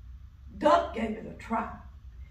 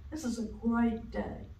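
usual speaking voice

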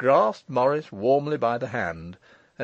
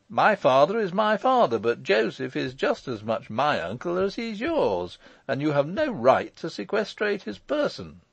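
raised, forceful arguing voice; assertive Michael; 4 semitones higher than usual. Acted. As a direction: press the point like a confident lawyer.

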